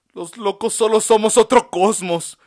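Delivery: high, loud declaration; passionate outcry. A narrator's reading. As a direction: proclaim it loudly and proudly. Patient's passionate claim.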